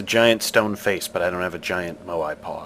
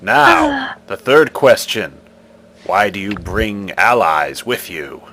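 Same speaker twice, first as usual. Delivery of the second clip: commanding stone guardian, louder; stone face voice; 8 dB louder than usual. Acted. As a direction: demand an answer like an ancient idol.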